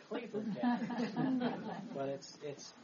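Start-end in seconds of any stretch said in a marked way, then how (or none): 0.1-1.1 laughing. Real, not acted. Joke punchline with laugh. 1.2-1.9 laugh at own joke. Real, not acted.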